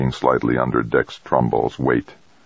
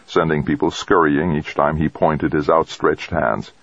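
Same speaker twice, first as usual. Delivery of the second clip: ordinary delivery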